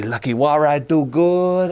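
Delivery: slow, light put-on Spanish accent; a slightly slowed, questionable Spanish accent; lightly faked Spanish accent, slow; mock Spanish accent. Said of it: "questionably racist accent".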